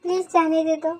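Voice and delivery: soft, tearful begging; tearful pleading. Acted. Frightened girl begging to be let go, tearful pleading. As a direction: plead quietly through tears. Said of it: "तू रोती भी है".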